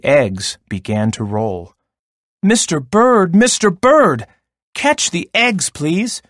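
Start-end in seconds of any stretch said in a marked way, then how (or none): 2.4-6.2 high, urgent character yell; storyteller acting out a raised, pleading shout; loud yell. A narrator's reading. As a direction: call frantically for help, pitched way up. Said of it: "yelled in her loudest voice".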